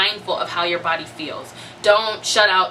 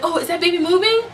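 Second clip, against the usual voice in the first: high, eager question; hopeful excitement; 9 semitones higher, 4 dB louder than usual. Retold or imitated. Mother-to-be thinking baby just moved, hopeful excitement. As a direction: re-enact a sudden hopeful lift.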